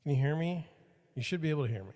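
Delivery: usual speaking voice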